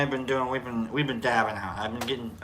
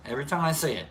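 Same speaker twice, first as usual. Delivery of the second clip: ordinary delivery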